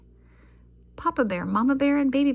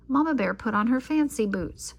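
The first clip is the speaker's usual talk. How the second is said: ordinary delivery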